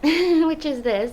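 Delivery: slow put-on American accent. Shown as a demonstration. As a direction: drawl it out in American. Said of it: "my American accent".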